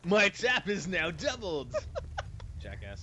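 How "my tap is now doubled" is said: slow, laughing announcer voice; game announcer imitation. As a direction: echo the game voice while laughing.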